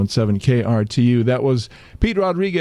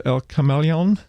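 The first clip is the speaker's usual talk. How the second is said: "El Camaleon" with flat American vowels, kuh-MAL-ee-on; gringo-style "El Camaleon", English r and a sounds; american accent spanish; 4 semitones higher than usual. Real, not acted. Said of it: "I'm saying it like a gringo".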